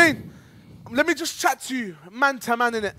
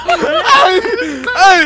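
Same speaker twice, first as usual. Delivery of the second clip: laughing; 7 semitones higher, 11 dB louder, much slower than usual. Real, not acted. Host laughs at caller's answers.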